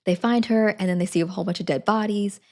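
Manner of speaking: ordinary talk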